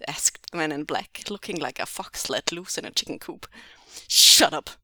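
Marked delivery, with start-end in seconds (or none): 4.1-4.7 loud furious yell; angry captor shouting. Acted. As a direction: bellow it at him.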